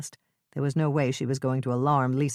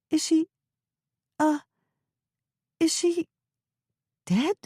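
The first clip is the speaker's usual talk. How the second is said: fearful Lisa; 14 semitones higher than usual. Acted.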